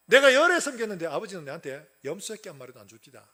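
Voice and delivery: loud, lightly acted grumbling complaint; mock reproach. Son reproaches his father over an unrewarded service, loud acted grumble. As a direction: scold as the resentful son, half in character. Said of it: "타박하는 겁니다".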